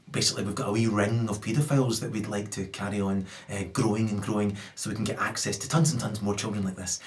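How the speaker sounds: quietly oily PR statement; lightly voiced executive smoothing it over for the press; mock corporate spin. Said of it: "photo opportunity to say".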